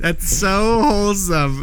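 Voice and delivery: slow, high, gushing mock praise. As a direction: coo it over something sweet. Mocking gushy tone.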